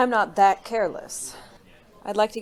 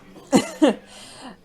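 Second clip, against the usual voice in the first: amused laugh; 6 semitones higher, 5 dB louder than usual. Acted. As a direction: laugh lightly, amused at him. Amused at detective's jealousy.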